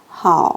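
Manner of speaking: 하오 dropped low in third tone; short 하오 sinking downward. Shown as a demonstration. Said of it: "하오는 내려주세요".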